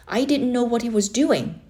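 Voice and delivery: ordinary delivery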